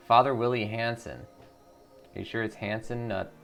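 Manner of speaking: ordinary delivery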